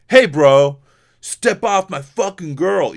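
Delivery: mock tough-guy shout. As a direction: puff up and bark it at the guys. Imagined stepping in on date.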